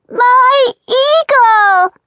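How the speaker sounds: put-on high, loud, drawn-out toddler shout. Toddler shouting for her brother, high slow and loud. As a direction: bellow it like a stubborn little child. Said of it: "Christina shouted".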